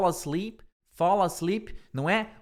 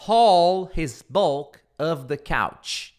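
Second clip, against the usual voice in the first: slow read-aloud; 5 dB louder, much slower than usual. Shown as a demonstration. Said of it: "reads sentence slowly".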